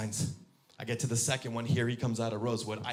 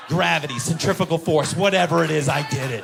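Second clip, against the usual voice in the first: loud, higher-pitched crowing; triumphant boasting; 4 semitones higher, 9 dB louder than usual. Acted. Driver crowing over his coffee save, triumphant shouting. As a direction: shout it out like a great feat. Bragging about the saved coffee.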